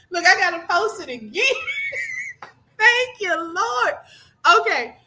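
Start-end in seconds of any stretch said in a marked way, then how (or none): 1.5-2.4 a high shriek of laughter. Real, not acted. Streamer cracking up at a joke, shrieking laugh. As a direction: let it burst out.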